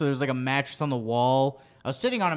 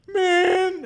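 high-pitched mock sob of a word; imitated crying. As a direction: imitate a friend whimpering, pitched way up. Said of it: "he's, like, crying".

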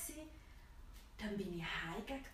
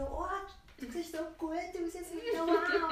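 high, delighted gush rising to a loud wow; imitating an enthusiastic best friend; 8 semitones higher, 5 dB louder than usual. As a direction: gush over her look like an excited friend. Friend admiring her outfit.

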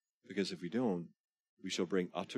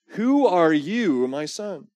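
slow, loud, higher questioning father; lightly voiced old father; 11 semitones higher, 14 dB louder, much slower than usual. Blind Isaac asking his son who he is, slow and loud. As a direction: draw out the blind father's doubt.

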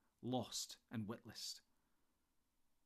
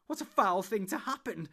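loud, high-pitched outburst; wild cry; 7 semitones higher, 10 dB louder than usual. A narrator's reading. Frodo finding friends trapped by the tree, crying wildly. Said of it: "cried Frodo wildly".

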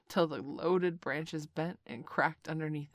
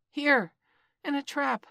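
higher, louder call for help; boy crying out; 6 semitones higher, 7 dB louder than usual. A narrator's reading. Trapped boy calling up to his friends, crying out. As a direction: shout up from the trap. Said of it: "cried Mowgli".

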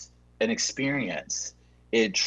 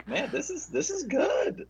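excited; 4 dB quieter than usual. Real, not acted. Delighted, high pitch.

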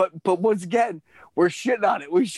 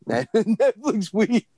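laughing; 5 semitones higher, much slower than usual. Real, not acted. Laughing echo of partner.

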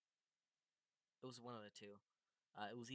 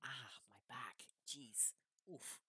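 pained whisper; 5 dB quieter, slower than usual. Real, not acted. Complaining of back pain.

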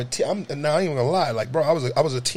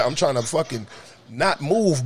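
ordinary delivery

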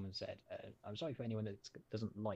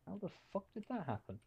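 shocked disbelief; 11 semitones higher, faster than usual. Retold or imitated. Tavern patrons react in shock.

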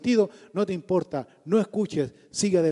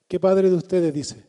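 ordinary delivery

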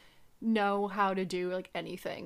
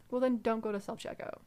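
ordinary talk, nothing marked

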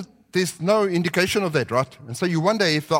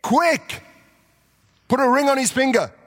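mock yell; 5 semitones higher than usual. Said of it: "he's yelling at his servants".

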